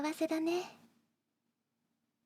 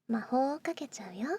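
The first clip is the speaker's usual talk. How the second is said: ordinary talk, nothing marked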